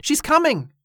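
high-pitched cry of alert; excited shout. A narrator's reading. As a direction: call out the news, voice jumping up. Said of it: "Danny shouted".

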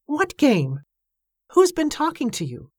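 high-pitched, urgent questioning; baffled woman. A narrator's reading. Evangeline demanding who talked to Joshua, high and baffled. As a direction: question him in a lifted, puzzled voice.

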